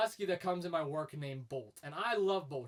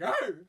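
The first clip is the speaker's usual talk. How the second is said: loud imitated husky howl, pitched up; husky back talk; 5 semitones higher, 8 dB louder than usual. Husky refusing to come inside, imitated back-talk howl. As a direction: answer back like a defiant dog. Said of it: "And he'll be like".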